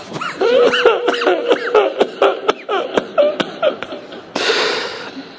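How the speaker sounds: high, drawn-out weeping; long sob. Real, not acted. Preacher mourning after Zeinab asks for water, long sobbing.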